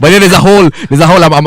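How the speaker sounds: excited laughing aside. Real, not acted. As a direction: push on while laughing. Still laughing, raised voice.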